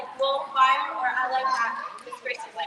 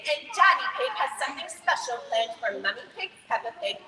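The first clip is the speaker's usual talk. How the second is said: ordinary talk, nothing marked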